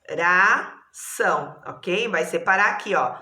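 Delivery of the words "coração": "coração" slowly as co, ra, ção; three separate beats on "coração", pausing between them; syllable by syllable. Shown as a demonstration. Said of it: "são três sílabas também". ("coração" devagar, sílaba por sílaba)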